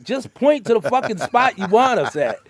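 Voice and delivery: loud, high and laughing; frustrated yell. Retold or imitated. Driver shouting at the signal guy, loud and high. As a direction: yell it half-laughing, exasperated.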